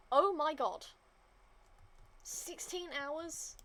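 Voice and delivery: loud gasp then slow disbelief; shocked. Real, not acted. Seeing a sixteen-hour timer, shocked disbelief. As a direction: react to the absurd wait in disbelief.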